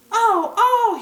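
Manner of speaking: very high, loud cry of pain; shouting. Acted. Dog fakes being attacked for Leopard, very high loud shout. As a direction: howl like he's being beaten. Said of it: "he shouted".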